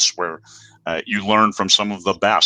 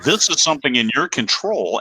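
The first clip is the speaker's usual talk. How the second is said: ordinary talk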